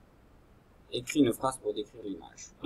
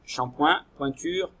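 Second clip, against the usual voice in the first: Shampoing and pointure said higher with a lifted end; teacher repeating words; 5 semitones higher, 6 dB louder, slower than usual. Shown as a demonstration. Teacher repeating the words for pupils, lifted. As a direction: sing out Shampoing, pointure as a model. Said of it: "Je répète".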